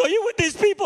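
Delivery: imitated wailing, very high and loud; tearful outburst. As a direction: burst like a broken dam, hammed-up. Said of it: "Like the dam just broke".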